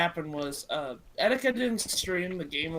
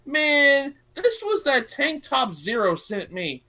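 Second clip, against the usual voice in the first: mock dismay, slow and pitched up; lightly acted upset whine; imitating upset Etika; 7 semitones higher, 6 dB louder, slower than usual. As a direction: lament it like a ruined treasure. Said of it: "he got so upset 'cause he's like".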